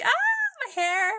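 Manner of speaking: pained yelp. Retold or imitated. Dog pinning her by hair.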